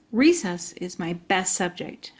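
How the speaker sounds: ordinary delivery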